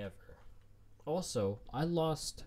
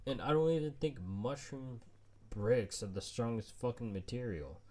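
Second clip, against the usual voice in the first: normal talking voice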